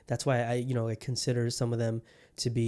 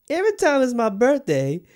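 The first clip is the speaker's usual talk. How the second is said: Every time it's my birthday shouted high and loud; high, loud, laughing disbelief; loud exclaim; 11 semitones higher, 8 dB louder than usual. Real, not acted. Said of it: "I just realized that".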